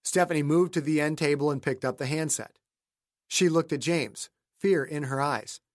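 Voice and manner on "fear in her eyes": plain reading voice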